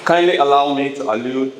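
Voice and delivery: ordinary talk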